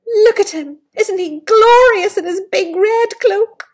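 high, loud excited exclaiming; delighted. Real, not acted. Parent showing the child the prince, loud and delighted. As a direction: gush over the picture.

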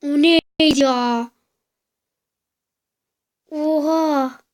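loud slow shocked question; startled outcry. Real, not acted. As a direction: shout it in disbelief. Startled in game.

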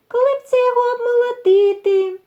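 very high, quick squeaky mouse voice; little mice. A narrator's reading. Mice suggest threshing the ear, high squeaky voice. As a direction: pipe up fast as a tiny mouse. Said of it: "мишенята та й кажуть".

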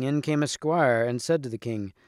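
ordinary delivery

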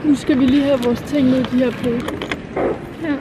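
muffled behind cloth mask; speaking masked; through a face mask. Real, not acted.

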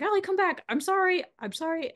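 high-pitched imitation of pleading; pleading apology. Wife imitating husband calling her back, pleading high.

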